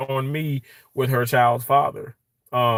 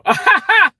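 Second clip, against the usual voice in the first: a sudden high, loud laugh; a real burst of laughter; 8 dB louder than usual. Real, not acted. Laughs at viewer's comment.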